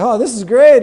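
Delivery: high, loud delighted quote. Retold or imitated. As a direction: gush like a kid set free. Pitcher delighted.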